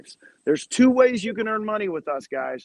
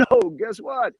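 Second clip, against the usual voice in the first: much higher, eager sell; pitching the idea to agents with excitement; excited pitch; 7 semitones higher than usual. Retold or imitated. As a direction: hook them with the big reveal. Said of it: "I go".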